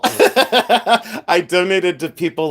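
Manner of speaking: slow words breaking out of a laugh. Real, not acted. Seller fixing her wording about people, laughing. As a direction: keep talking while still cracking up.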